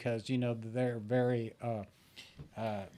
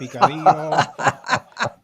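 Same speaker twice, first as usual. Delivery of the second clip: laughing through the word, slow and much louder; 2 semitones higher, 11 dB louder, much slower than usual. Real, not acted. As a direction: break into a laugh while drawing out picadillo. Teasing guest about Cuban food.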